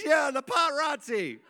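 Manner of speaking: put-on high little-girl voice insisting on "paparazzi"; wailing child. Daughter insisting to dad on "paparazzi", high childish wail. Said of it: "and she started crying".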